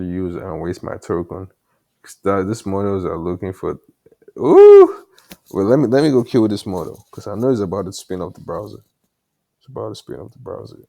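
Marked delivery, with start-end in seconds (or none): 4.4-5.0 sharp high-pitched ooh of alarm; a loud startled squeal; high surprised ooh. Real, not acted. Sudden alarm at the model. 9.6-10.8 muttered quickly under breath; quiet fast mutter. Real, not acted. Repeats the line under breath.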